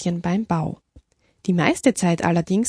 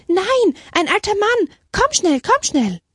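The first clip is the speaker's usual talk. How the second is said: high, impatient urging; urgent excitement; 13 semitones higher, 3 dB louder than usual. Acted. Sister correcting the abbess and fetching her to the door, urgent excitement.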